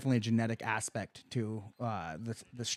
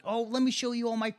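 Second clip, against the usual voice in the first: lightly imitated mom, much higher pitch; higher-voiced mock show-off; 11 semitones higher than usual. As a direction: pitch it up as an eager retold mom. Voicing mom showing off ribbons.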